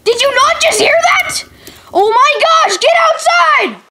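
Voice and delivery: exasperated yelling. Acted. Frustrated teacher yelling.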